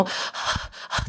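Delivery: quiet, tense panting breaths; nervous breathing. Retold or imitated. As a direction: imitate the neighbours holding their breath. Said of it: "横の人とかも". (息をひそめてハァハァ)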